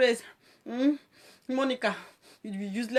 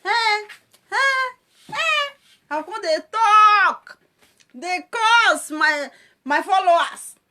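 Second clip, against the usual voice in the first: high, loud wailing on slow words; mock crying rant; 9 semitones higher, 9 dB louder, much slower than usual. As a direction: wail at the followers like a live video breakdown. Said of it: "I go enter live video".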